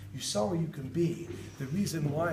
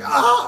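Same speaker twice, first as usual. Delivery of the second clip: high loud running yell; a big hammed-up shout while running; mock yell; 9 semitones higher, 12 dB louder than usual. Dad running behind the bike, high loud yell.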